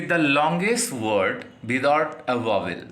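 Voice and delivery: very slow, word by word; slow dictation. Shown as a demonstration. Teacher dictating to students, slow and deliberate. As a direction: read it out slowly so students can write.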